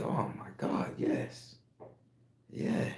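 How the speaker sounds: hushed, breathless whispered yes; excited whisper. Acted. Founding fathers secretly agree, in a thrilled whisper.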